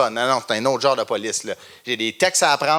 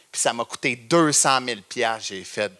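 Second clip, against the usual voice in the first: normal talking voice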